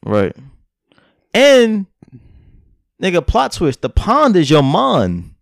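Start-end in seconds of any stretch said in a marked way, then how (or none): 3.8-5.3 "mind" said as "mon", drawn out slowly; slow line with "mind" coming out "mon"; mind sounds like mon. Real, not acted. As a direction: flatten the vowel of "mind" to "ah". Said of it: "It sound like you're saying mon".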